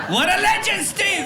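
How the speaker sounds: high, punchy cheer for a mate; host hailing a legend; hyped acclaim. Acted. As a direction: whoop it out to the crowd. Hailing the interpreter to crowd.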